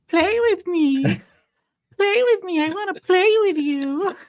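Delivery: put-on very high, creepy singsong child voice; scary little girl. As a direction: lure them to play like a ghostly girl. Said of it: "this scary little girl".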